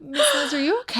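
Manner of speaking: very high-pitched concerned student; imitating worried girls. Girls checking on their pregnant coach, imitated high and worried. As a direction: mimic a worried kid, voice way up.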